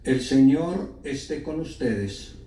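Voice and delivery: usual speaking voice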